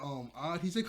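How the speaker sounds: ordinary talk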